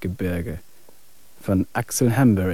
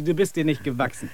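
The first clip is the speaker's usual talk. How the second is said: ordinary talk, nothing marked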